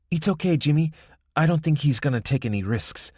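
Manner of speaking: lightly voiced Spanish accent. A narrator's reading. Young woman calming gunman.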